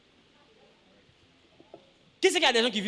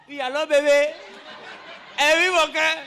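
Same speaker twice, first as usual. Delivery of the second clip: mock lover on phone. Preacher mimicking a woman answering her lover's call, laughing sweetly. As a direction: coo into the phone like a smitten lover.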